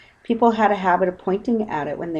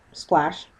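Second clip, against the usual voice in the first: plain reading voice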